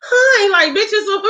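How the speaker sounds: mock the fake sweet voice adults use with kids; high sing-song kid voice. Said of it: "What is they doing?".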